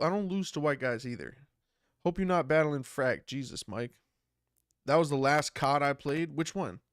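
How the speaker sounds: normal talking voice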